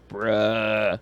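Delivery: normal talking voice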